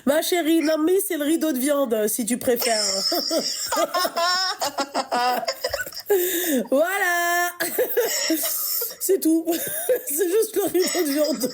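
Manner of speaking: laughing through the words; cracking up mid-sentence. Real, not acted. Host cracks up at own joke.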